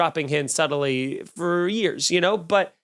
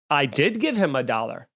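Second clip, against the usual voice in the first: ordinary talk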